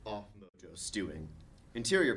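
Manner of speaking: ordinary talk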